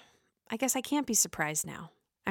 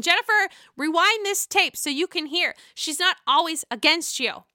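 higher and louder, calling her out; 7 semitones higher, 4 dB louder than usual. Real, not acted. Vlogger urging Jennifer to rewind the tape, calling out loudly. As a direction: call out to Jennifer through the screen. Addressing Jennifer through the camera.

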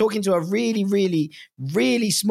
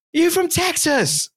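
excited; 12 semitones higher, much slower than usual. Retold or imitated. Portuguese fans quizzing the Texan.

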